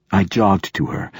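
ordinary delivery

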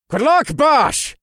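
high, slow, called-out farewell; Jorgen's voice, shouted across battle; calling out. Acted. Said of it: "Jorgen called".

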